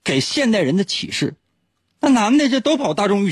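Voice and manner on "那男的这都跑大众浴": laughing. Real, not acted. Joking about the bathhouse.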